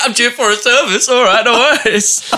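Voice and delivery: mock casual voice. Chris imitated at car.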